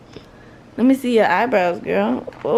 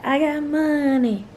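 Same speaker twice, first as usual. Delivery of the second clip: show-off; 6 semitones higher, slower than usual. Shown as a demonstration. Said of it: "pull the money out and be like".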